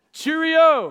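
put-on playful British accent; mock British farewell. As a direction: toss off a hammed-up British goodbye. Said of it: "by the content of the language".

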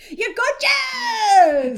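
high, drawn-out hype yell; mat talk. Acted. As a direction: whoop it like a cheer squad hyping someone. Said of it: "Jerry to Matt talk my life".

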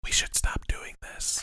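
slow hushed whisper. Real, not acted. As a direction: whisper it slowly. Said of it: "Why are we whispering?".

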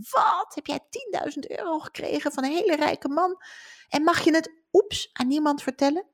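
astonished best friend. Retold or imitated. Best friend hearing about the money, shrill astonishment. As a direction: squeal it out in disbelief. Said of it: "die beste vriend zegt".

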